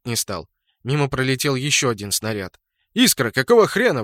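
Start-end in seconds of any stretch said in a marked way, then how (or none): 2.9-4.0 raised, louder outburst of indignation; indignant. A narrator's reading. Clan leader rebukes his attacking clanmate, indignant. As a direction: demand an explanation, voice jumping up. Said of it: "возмутился Кхорн".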